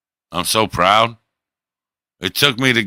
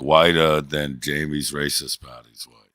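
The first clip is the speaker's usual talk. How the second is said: ordinary talk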